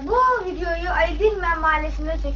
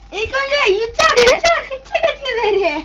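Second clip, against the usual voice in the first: excited; 6 semitones higher than usual. Real, not acted. Hyped announcing swallowing the pepper.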